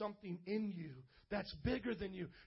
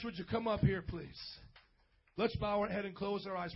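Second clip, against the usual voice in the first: normal talking voice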